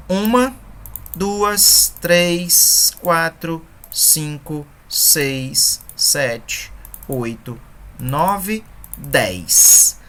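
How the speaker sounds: each number spaced out, syllable by syllable; counting syllables aloud. Shown as a demonstration. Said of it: "Vamos contar quantas sílabas agora". (contagem pausada das sílabas)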